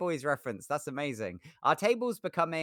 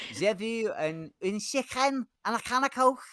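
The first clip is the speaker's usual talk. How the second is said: high comic French-Scouse mix; French Scouser; 9 semitones higher than usual. Acted. Streamer reading a chat joke, high French-Scouse put-on. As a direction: slide from French into thick Scouse and back. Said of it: "French Scouser, weird combo".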